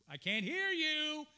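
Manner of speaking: high, very loud drawn-out call; teasing shout. Retold or imitated. Son jokes with mother on the phone, teasing shout. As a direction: holler it playfully, stretching the last word. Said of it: "It was kind of a little joke".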